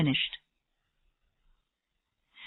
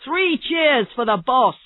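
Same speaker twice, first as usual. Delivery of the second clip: slow, raised rallying cry; shouting a cheer; 5 semitones higher, 4 dB louder than usual. A narrator's reading. Birkin to the team as flowers arrive, shouted cheer. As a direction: lead the room in a drawn-out cheer. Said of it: "Birkin started shouting".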